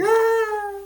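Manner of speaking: high, loud mock scream. Family reacts to Clark being shot, high mock scream. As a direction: imitate a long, high shriek.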